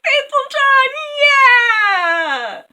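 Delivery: high, loud whooping cheer; joyful cheer. Retold or imitated. The king cheering as Faithful John returns, overjoyed. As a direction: cheer him back to life. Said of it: "He's like".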